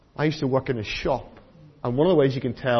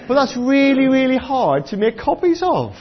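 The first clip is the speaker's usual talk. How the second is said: emphatic loud; 9 semitones higher, 5 dB louder than usual. Real, not acted. Repeats point loudly after laugh.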